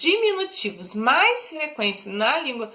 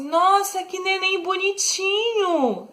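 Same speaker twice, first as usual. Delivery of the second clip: put-on high, tender baby talk; affectionate; 7 semitones higher than usual. Shown as a demonstration. Teacher showing a loving compliment to a baby. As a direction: coo over the baby sweetly. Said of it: "Carinhosa, eu tô sendo fofa".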